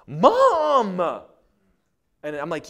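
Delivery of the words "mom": much higher, louder whiny yell of Mom; bratty call. Retold or imitated. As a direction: demand Mom's attention like a spoiled kid. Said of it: "she starts talking to her mom, like".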